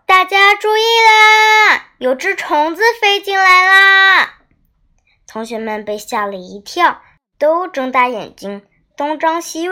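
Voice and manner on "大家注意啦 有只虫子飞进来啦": high, loud teacher's alarmed shout, last syllable stretched; 惊讶高喊. Acted. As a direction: call out to the whole class in startled alarm. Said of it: "田老师提高了声音，惊讶地叫道". (惊讶地提高嗓门大喊)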